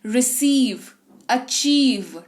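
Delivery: रिसीव and अचीव with "ee" held long; lingering long ee. Shown as a demonstration. Teacher showing the vowel lingers, long held "ee". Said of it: "ठहराव है आई के पास".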